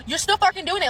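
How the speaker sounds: high-pitched exasperated accusation; agitated retort. Retold or imitated. Tripping narrator accusing her friend of shaking, agitated. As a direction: retell it as a heated, high protest. Panicked argument with friend in car.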